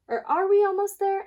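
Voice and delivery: high, loud, fast hen voice; doubting hen. Acted. Hen asking the fox if they are near, high and fast. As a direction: question the fox in a high rush.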